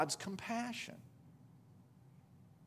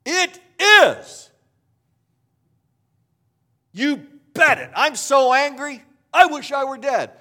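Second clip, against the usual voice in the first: Jonah shouting that he is furious, much higher and louder; angry Jonah; 10 semitones higher, 13 dB louder than usual. Retold or imitated. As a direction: bellow it like a sulking prophet who wants to die. Voicing angry Jonah's answer.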